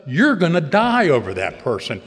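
the sponsor leans in on the point; sponsor confronting. Retold or imitated. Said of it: "He said".

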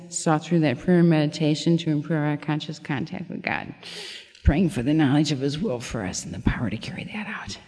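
soft, trailing into a whisper; reciting the long step eleven to the group, tired and fading. Real, not acted. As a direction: read it out worn out, fading. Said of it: "I think I'm tired".

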